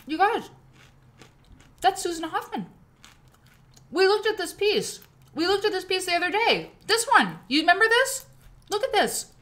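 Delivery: high, loud gushing; thrilled, high chatter; excited. Real, not acted. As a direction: gush to the viewers about the piece. Excited over seeing quilt.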